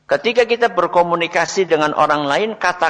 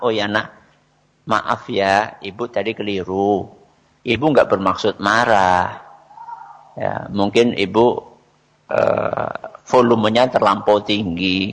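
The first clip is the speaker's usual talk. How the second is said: low, calm motherly apology; lowered intonation; 7 semitones lower than usual. Shown as a demonstration. Mother apologises to her child, low calm voice. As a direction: gently own up in a lowered pitch. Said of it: "ya kita turunkan ... Intonasinya, volumenya".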